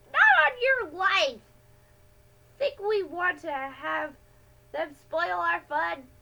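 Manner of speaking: put-on boy scoffing at telling the police, loud and high; outraged refusal. A narrator's reading. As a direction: shout it down like the idea is absurd. Poetry refuses to call police.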